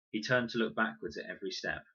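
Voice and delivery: plain reading voice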